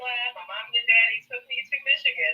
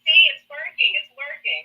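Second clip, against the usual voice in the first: mocking them; 5 semitones higher, 8 dB louder than usual. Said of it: "They're gonna run with it".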